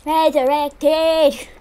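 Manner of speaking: RESURRECTED stretched slowly; drawn-out repeat. Pretend. Player echoing "Resurrected" after reviving, slow and drawn out. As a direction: say "Resurrected" slowly, dragging each syllable.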